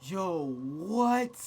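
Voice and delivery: shocked yo. Real, not acted. Shocked reaction.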